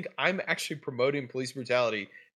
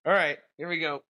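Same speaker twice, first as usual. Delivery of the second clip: usual speaking voice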